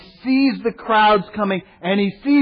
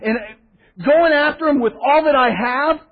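higher, louder, fired-up; fired-up Peter; 6 semitones higher, 4 dB louder than usual. Retold or imitated. Peter drawing his sword for Jesus, higher and louder with zeal. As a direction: charge ahead with zeal. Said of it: "Peter is just going".

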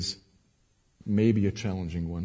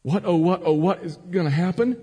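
higher-pitched, mildly fretful pleading; mock anxious question; 8 semitones higher, 3 dB louder than usual. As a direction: replay the worried question, theatrically. Repeats the worried question before answering.

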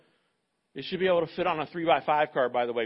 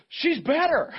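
much higher and louder with joy; excited; 10 semitones higher, 5 dB louder, slower than usual. Retold or imitated. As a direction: blurt the good news high and loud. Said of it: "they're gonna go".

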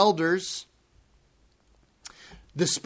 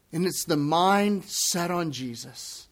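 ordinary talk

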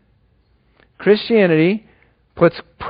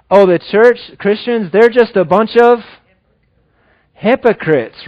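higher-pitched voice of the judging world; dismissive outsider; 4 semitones higher than usual. Acted. The world talking about Christians, dismissive. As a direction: toss it off as a critic of churchgoers. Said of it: "this is the world thinking about us".

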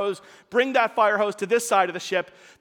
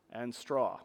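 much softer, let straw fall away; dropped soft; 10 semitones lower, 12 dB quieter than usual. Acted. Preacher landing the last fuel item, much softer. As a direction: drop it to almost nothing. List ends on the key fuel.

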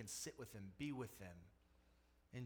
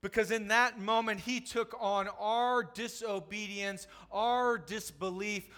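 higher, louder passionate preaching; full-force preaching; 6 semitones higher, 9 dB louder than usual. Acted. Preacher urging the congregation about the cross, full-force preaching. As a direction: drive the point home from the pulpit.

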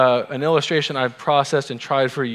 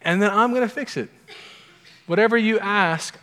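emphatic promise; 8 semitones higher than usual. Acted. Jesus quoted with emphasis.

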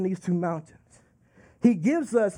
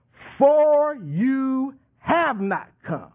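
FOR and YOU stretched out slowly, NOT COME landed firmly; emphatic; 2 semitones higher, much slower than usual. Acted. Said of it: "there's an emphasis there".

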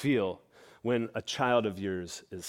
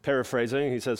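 normal talking voice

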